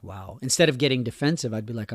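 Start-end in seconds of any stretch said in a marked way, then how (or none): 0.0-0.4 hushed wow. Retold or imitated. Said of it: "take a pause and be like".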